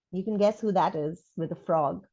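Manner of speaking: ordinary delivery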